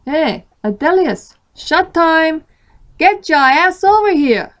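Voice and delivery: high, loud Southern holler; throaty Southern accent. A narrator's reading. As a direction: call across the bar in a throaty Southern accent. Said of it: "in his throaty southern accent".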